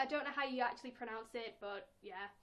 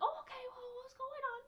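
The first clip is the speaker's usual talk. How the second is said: put-on much higher, innocent four-year-old; little girl voice; 12 semitones higher than usual. Herself at four answering the neighbour boy. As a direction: sound young and trusting. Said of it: "And I was like".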